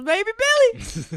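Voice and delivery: squealing the name high and slow, thrilled; gushing like a delighted fan; excited squeal. Real, not acted. Fan spotting his favorite actor, squealing with delight.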